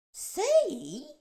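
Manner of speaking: high, loud outraged shout; earthworm shouting. A narrator's reading. The earthworm shouts at James, offended. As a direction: fling the word back at him. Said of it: "shouted the poor earthworm".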